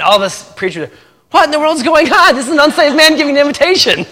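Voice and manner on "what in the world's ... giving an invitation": high, loud yell of disbelief; hammed-up panicked outburst, pitched way up; mock outraged shock. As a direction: cry out like a scandalized preacher, played for laughs. Retold panic of the shocked preachers.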